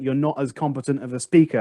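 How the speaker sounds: normal talking voice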